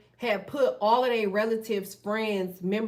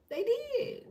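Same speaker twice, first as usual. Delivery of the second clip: "They did" jumped much higher, squeaky insistence; high emphatic echo; 13 semitones higher than usual. Real, not acted. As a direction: toss it back up high, half-mocking.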